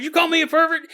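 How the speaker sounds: high, loud mimicked challenge; angry accusation. Young man walks up to this guy, angry challenge. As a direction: imitate an outraged man squaring up. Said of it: "starts going".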